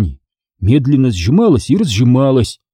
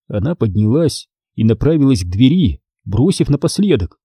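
ordinary delivery

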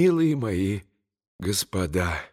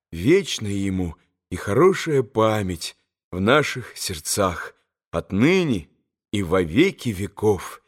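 plain reading voice

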